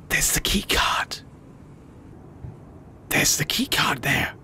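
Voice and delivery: slow unvoiced whisper. Real, not acted. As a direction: whisper so he won't hear. Sneaking in a room.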